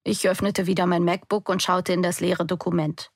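ordinary delivery

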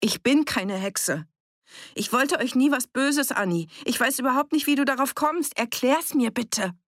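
high, urgent appeal; heated, pleading self-defence; insistent protest. A narrator's reading. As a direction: protest innocence and demand an answer. Protesting her innocence, demanding explanation.

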